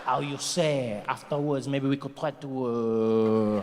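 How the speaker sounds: put-on low, seductive French accent; a hammed-up French lover. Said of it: "They've got that thing".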